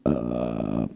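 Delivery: very low croaked uh; low guttural beatbox noise; low grunt. Shown as a demonstration. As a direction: drop into a gravelly low uh. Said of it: "a simple sound like".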